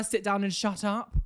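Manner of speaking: ordinary talk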